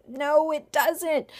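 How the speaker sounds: put-on slow, loud, emphatic answer to children. Shown as a demonstration. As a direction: stretch it out for the kids. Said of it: "Does it taste very good?".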